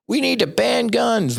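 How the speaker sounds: high, mocking voice of gun-control outcry. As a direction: parody the panicked public.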